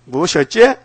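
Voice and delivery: loud acted retort; mock indignation. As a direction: flare up like someone just insulted. 보통 사람의 발끈한 반응. (발끈해서 크게 되묻는 흉내)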